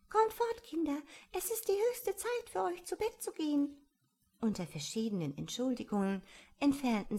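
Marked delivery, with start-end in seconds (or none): 0.1-3.7 very high piping little bird; trembling canary. A narrator's reading. Ein Kanarienvogel hurries its little ones to bed, trembling peep. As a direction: pipe it anxiously, far above normal pitch. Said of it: "ein Kanarienvogel piepte zitternd".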